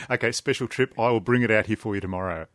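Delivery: ordinary delivery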